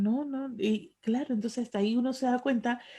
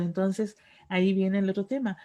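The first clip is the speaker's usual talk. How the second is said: ordinary talk, nothing marked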